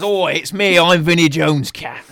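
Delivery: lightly voiced thuggish cat; Vinnie Jones' cat. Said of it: "And then it goes".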